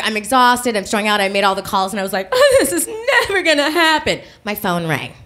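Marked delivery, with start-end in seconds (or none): 2.3-4.2 high, laughing-crying hopelessness; half-sob it out; mock despair; despairing wail.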